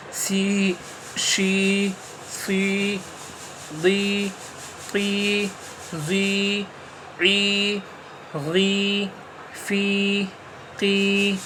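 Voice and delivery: each syllable sounded out clearly; syllable drill. Shown as a demonstration. Teacher drilling syllables for learners, clear and even. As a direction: call out syllables one by one.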